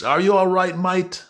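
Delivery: high-pitched English greeting; English accent. Shown as a demonstration. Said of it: "Or English".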